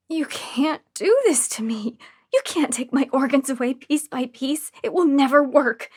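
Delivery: from a hushed whisper to a loud cry; helpless patient facing organ theft; desperate. A narrator's reading. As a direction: fear swells into an outburst. Patient's desperate inner plea.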